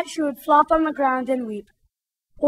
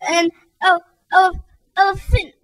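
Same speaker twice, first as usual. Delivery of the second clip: higher voice stumbling through tears; weeping; 6 semitones higher than usual. Acted. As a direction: stammer it out while weeping. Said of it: "Weeps and all".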